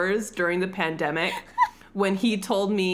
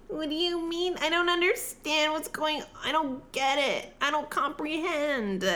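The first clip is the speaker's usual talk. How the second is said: baffled pleading; 8 semitones higher, 3 dB quieter than usual. Acted. Molly, not understanding being told to leave, high baffled pleading.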